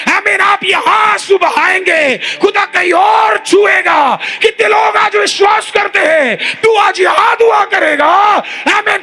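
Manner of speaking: high, loud, slow proclamation shouted to the church; preaching at full force. Acted. Pastor shouting God's power to the congregation, full-force preaching. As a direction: build to a roaring altar-call climax.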